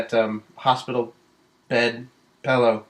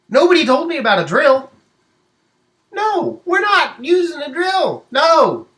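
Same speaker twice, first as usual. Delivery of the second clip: high, loud panicked protest; hammed-up terror at the drill; mock panic; 7 dB louder than usual. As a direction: squeal the refusal in alarm.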